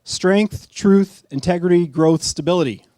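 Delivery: blurted list. Retold or imitated. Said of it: "And I went".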